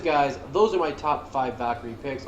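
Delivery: normal talking voice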